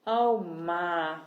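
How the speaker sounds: ordinary delivery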